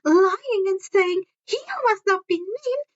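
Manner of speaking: syrupy, condescending teacher voice; sugary Umbridge. Acted. Umbridge scolding Harry before the class, saccharine.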